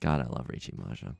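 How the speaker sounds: low, soft, deadpan aside; muttered sarcasm. Real, not acted. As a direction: mutter it to yourself, low and resigned. Deadpan sarcasm after a bad turn.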